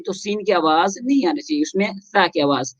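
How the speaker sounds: usual speaking voice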